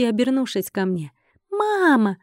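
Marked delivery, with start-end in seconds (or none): 1.5-2.2 high, squeaky happy cry; joyful little creatures. A narrator's reading. Baby trolls greet their mother, high joyful cry. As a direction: call out gleefully like tiny critters. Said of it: "радостно воскликнули кочки".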